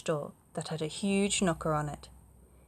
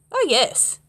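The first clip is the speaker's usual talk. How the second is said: proud dragon; 7 semitones higher, 9 dB louder than usual. Acted. Dragon boasts.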